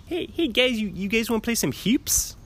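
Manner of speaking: very high, hammed-up Maryland accent. Shown as a demonstration. Calling the guys to play hoops, in a mock Maryland accent. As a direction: pitch it way up and lay on the Maryland vowels. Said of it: "pretty good Maryland accent".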